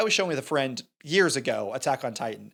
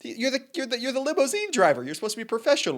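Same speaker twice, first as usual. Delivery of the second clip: high-pitched, exasperated imitation; mock protest; 9 semitones higher than usual. Cop scolding his limousine driver, mock protest. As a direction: object in a raised, incredulous pitch.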